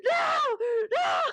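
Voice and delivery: high mock scream of NO; acted screaming. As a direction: scream it like a hammed-up moviegoer in despair.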